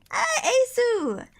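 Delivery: high, slow drawn-out call. Real, not acted. Calling to a friend, high drawn-out shout. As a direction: stretch the name out high.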